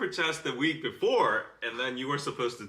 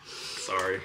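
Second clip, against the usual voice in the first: usual speaking voice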